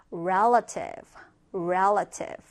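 low teaching "relative", each one set apart; model pronunciation. Shown as a demonstration. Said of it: "발음 따라해볼까요?".

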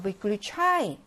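"we could try" as Russian vyklyuchai, last syllable stressed and stretched. Shown as a demonstration. Teacher models stressed vowel, "try" said as a long "chai". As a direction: draw out the "ai" ending of "try". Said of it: "얘는 강세가 아에 있어요".

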